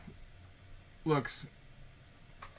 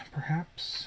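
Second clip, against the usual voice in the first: whisper; 4 semitones higher, 3 dB quieter than usual. Real, not acted. Quiet wondering aside.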